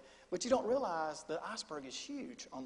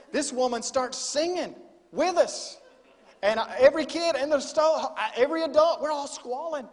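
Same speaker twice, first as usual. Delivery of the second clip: rising emotion; 7 semitones higher, 8 dB louder than usual. Real, not acted. Emotional climax of story.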